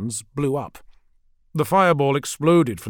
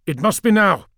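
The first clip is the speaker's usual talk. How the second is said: loud, high-pitched urging; urgent command; 7 semitones higher, 6 dB louder than usual. Acted. Commander to his brothers over the vox, urgent command. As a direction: press your allies to act at once. Urgent order during space battle.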